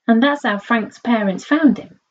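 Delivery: ordinary delivery, nothing marked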